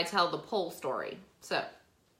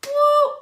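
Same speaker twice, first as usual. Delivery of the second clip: high, loud cheer; loud high victory whoop; celebratory whoop; 15 dB louder than usual. Real, not acted. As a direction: whoop in triumph. Celebrating the offer.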